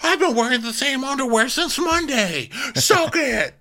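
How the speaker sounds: very high, slow bratty taunt; co-host mocking Shep's defiance, very high. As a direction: taunt like a brat. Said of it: "So Shep".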